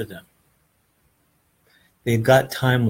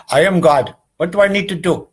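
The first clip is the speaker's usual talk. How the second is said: louder, higher self-proclaimed god; mock proud claim; 6 semitones higher, 7 dB louder, faster than usual. Spiritual teacher mocking believers, in a loud grand claim.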